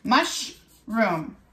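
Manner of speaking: "Mushroom" broken into two beats, mush, room; split into syllables. Shown as a demonstration. Teacher has children count chin drops, "Mushroom" split in two. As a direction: say "Mushroom" in two separate pieces. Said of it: "Mushroom has two syllables".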